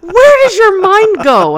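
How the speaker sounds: slow, high and loud through laughter; laughing disbelief. Retold or imitated. Husband amazed at her wild guesses, slow and laughing. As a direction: drag it out, cracking up.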